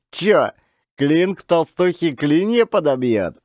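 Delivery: high-pitched, teasing thug voice; sly jeer. Acted. Gang gossiping about Klin and Varvara, sly jeer. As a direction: leer knowingly about the boss. Thugs gossip from behind bushes.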